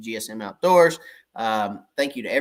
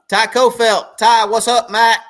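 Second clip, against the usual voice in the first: excited shout-out; 9 semitones higher, 10 dB louder than usual. Real, not acted. Greeting viewers by name.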